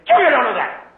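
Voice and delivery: put-on high, loud scolding of a child; a parent scolding a child out of irritation; irritated parent.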